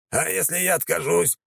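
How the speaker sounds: put-on high, strained old man; a high nervous old man asking; old count voice.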